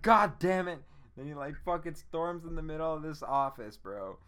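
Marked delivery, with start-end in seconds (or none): 0.0-0.8 much louder and higher curse; hammed-up angry shout; mock angry outburst. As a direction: blow up in fake fury. They're furious about the TiVo.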